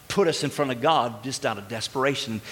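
normal talking voice

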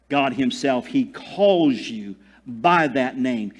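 ordinary talk

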